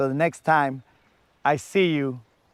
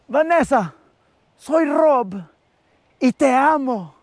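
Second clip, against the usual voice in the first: much higher, louder hammed-up soap hero; telenovela love confession; 9 semitones higher, 4 dB louder than usual. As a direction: swoon it out like a mock telenovela lover. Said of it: "Did you open the door?".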